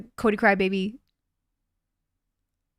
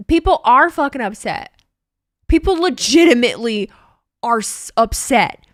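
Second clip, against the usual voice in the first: emphatic; 7 dB louder than usual. Real, not acted. Stressing fans are upset.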